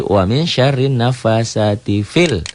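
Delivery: "fil" with a short i closed straight onto the l. Shown as a demonstration. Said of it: "tidak dibaca panjang". ("fil" tidak dibaca panjang)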